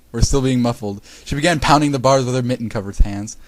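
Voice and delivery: ordinary delivery, nothing marked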